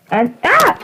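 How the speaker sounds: excited yelp; sudden excited cry. Real, not acted. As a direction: burst out with the find.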